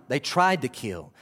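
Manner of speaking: normal talking voice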